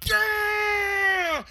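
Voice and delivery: high, loud victory yell; triumphant shout. Retold or imitated. Cheering the catch at a wedding, loud triumphant shout. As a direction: leap up and roar it.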